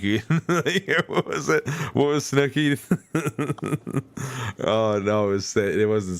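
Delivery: high, laughing through the words. Real, not acted. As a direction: giggle while trying to remember. Laughing over a reality-TV quote.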